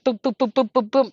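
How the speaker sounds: rhythmic, evenly spaced booms; imitated footfalls. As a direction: mimic steady running feet, beat by beat. Imitating steady footfalls behind runner.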